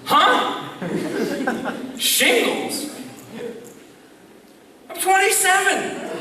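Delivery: high-pitched mock disbelief; incrédule. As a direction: replay being stunned by the diagnosis. Retold shock at diagnosis.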